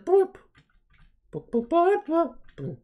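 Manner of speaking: high boop noises; squeaky mock beeps; toy beeps. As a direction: do a toy's electronic boops.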